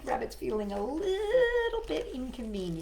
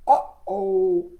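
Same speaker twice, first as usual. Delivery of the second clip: croaking frog; 11 dB louder than usual. Acted. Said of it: "croaked Froggy".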